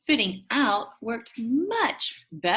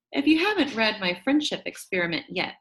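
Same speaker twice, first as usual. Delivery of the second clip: usual speaking voice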